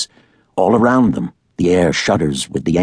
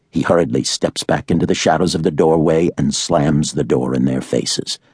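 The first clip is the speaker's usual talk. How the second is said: ordinary delivery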